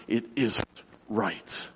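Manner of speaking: soft, halting, dropping low on the last word; understated great emotion. Retold or imitated. Preacher retells boy speaking through tears, understated emotion. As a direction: pause before the final word, hushed. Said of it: "with great emotion, he spoke the words".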